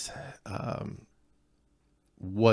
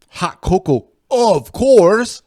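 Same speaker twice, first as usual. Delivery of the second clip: "hot cocoa, of course" mimicked much higher and louder in a Scots-Irish accent; mock her words in a loud, high Scottish-Irish accent; mocking imitation in Scots accent; 11 semitones higher, 12 dB louder, slower than usual. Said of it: "why do you say it like that".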